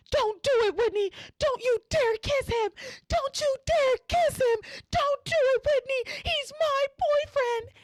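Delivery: high, loud hammed-up screech; screaming. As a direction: scream it at the window like a jealous girlfriend.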